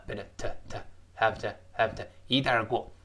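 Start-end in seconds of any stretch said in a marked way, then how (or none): 0.4-2.0 t, t, have to said soft and voiceless; weakened to. Shown as a demonstration. Teacher showing reduced have to. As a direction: breathe have to, barely voiced. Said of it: "也弱化成".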